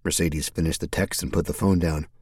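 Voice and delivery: usual speaking voice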